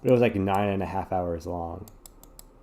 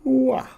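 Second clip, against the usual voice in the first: high, loud drawn-out yawn; 7 dB louder than usual. Real, not acted. Yawning on a cozy sleepy day. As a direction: let a big yawn out. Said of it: "I'm very yawny today".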